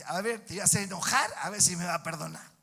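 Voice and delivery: loud taunting challenge; mock provocation. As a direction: act out goading someone, very loud. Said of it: "Decírselo así, así, así".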